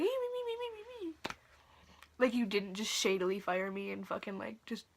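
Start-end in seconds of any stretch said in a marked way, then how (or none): 0.0-1.1 mocking very high squeaky babble; high singsong mockery, fading away; fake nice. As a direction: mimic phony niceness in a tiny falsetto. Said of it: "she's just acting all fucking nice".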